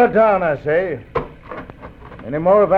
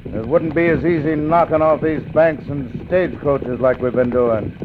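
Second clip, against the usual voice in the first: normal talking voice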